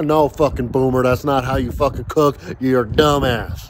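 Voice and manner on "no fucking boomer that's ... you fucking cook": ordinary talk, nothing marked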